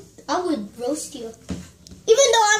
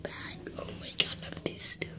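hushed whisper while hiding; 11 dB quieter than usual. Real, not acted. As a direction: breathe the words, barely voiced.

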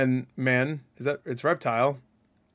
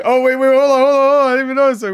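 excited; 10 semitones higher, 8 dB louder, faster than usual. Real, not acted. Spots detail in video.

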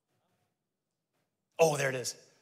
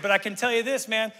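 ordinary talk, nothing marked

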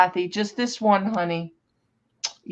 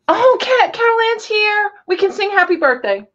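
excited; 11 semitones higher than usual. Real, not acted. Birthday girl arrives.

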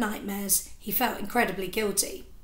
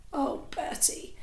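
fond laugh; 4 semitones higher, 8 dB quieter, slower than usual. Acted. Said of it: "couldn't help but laugh".

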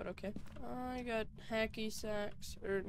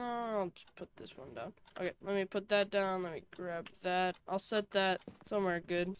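usual speaking voice

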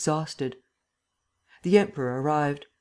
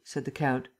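usual speaking voice

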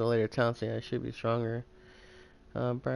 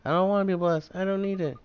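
frightened pleading; 6 semitones higher than usual. Acted. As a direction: plead to be let go, a bit scared. Girl refuses the blessing.

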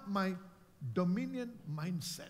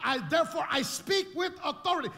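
dominion declaration; 5 semitones higher than usual. Acted. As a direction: declare it like a ruler. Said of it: "with a dominion mindset".

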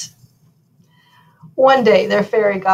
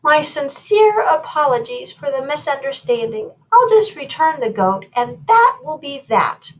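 lightly voiced godmother, pitched higher; a hint of formal, higher-voiced apology; apologetic fairy godmother; 7 semitones higher, 5 dB louder than usual. A narrator's reading. Godmother apologises for the misunderstanding, lightly voiced brisk apology.

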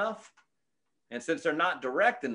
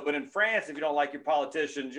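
ordinary talk, nothing marked

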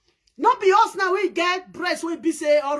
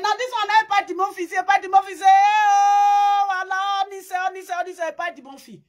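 mock wailing; 6 semitones higher, 4 dB louder than usual. Comedian reacting to the video, mock wailing. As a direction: cry out theatrically, rushing the words.

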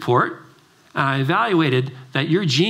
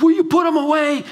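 high-pitched, louder irritated outburst; freaking out; 11 semitones higher, 4 dB louder than usual. Retold or imitated. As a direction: imitate a teen son losing it. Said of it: "he freaks out".

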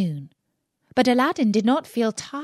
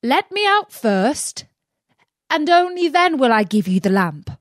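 calling out; 5 semitones higher, 4 dB louder than usual. Acted. Aladdin in the cave bargains with the magician, higher call. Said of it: "Aladdin called out".

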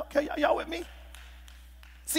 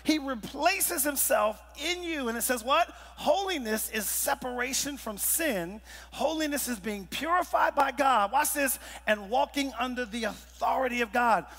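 fired-up preaching; 5 semitones higher than usual. Acted. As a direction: lift the room as you read the verse. Preacher reading scripture, fired up.

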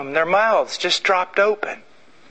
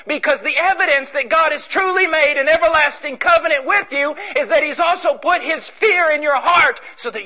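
full-force preaching; 8 semitones higher than usual. Acted. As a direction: hammer the doctrine home.

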